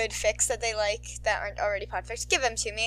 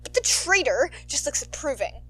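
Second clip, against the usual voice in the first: ordinary delivery, nothing marked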